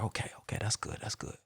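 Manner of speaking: hushed, voiceless aside; whispered self-talk. Retold or imitated. Listening before his turn to speak, whispering to himself. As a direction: whisper it as if only to yourself. Silently assessing the other speakers.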